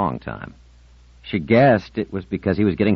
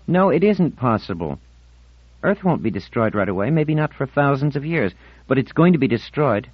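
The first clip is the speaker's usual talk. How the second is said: put-on higher-pitched girl, flatly refusing doubt; adamant Marlena; 9 semitones higher, 4 dB louder than usual. Marlena denies to Ornell that she imagines it, adamant. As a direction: contradict him in a high, certain voice.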